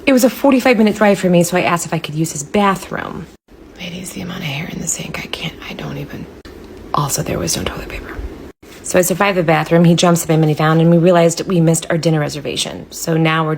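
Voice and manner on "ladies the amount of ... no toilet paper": hushed, breathy storytelling; whispered confession to viewers; whispered aside. Acted. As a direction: let them in on a secret.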